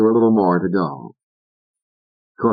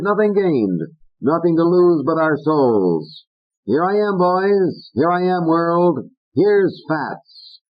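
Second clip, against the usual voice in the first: high, brassy speech parody; a puppet hamming famous lines; Fats's voice; 9 semitones higher than usual. Acted. Fats reciting mashed quotes.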